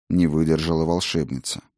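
plain reading voice

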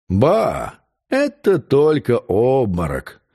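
slow, unbothered drawl; calm, dismissive. A narrator's reading. La Roche-Boisseau making light of the fainting, calm and slow. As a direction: brush it off at a leisurely pace. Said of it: "спокойно сказал Ларош-Буассо".

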